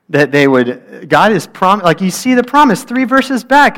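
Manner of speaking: high, loud exasperated rant; angry outburst. Retold or imitated. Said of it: "I got so angry".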